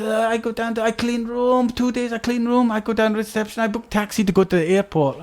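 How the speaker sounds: plain-spoken Swedish cellmate; mock Swedish accent. Cellmate lists his steps in mock Swedish accent. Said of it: "He said".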